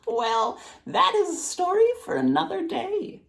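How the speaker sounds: put-on high, teasing old woman; old woman's voice. A narrator's reading. Old woman deflects the weaver's question, high and teasing. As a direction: tease it like a sly grandmother. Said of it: "The old woman chuckled and replied".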